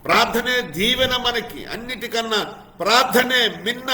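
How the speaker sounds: high, loud preacher's cry; full-force preaching. Acted. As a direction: proclaim it to the whole church.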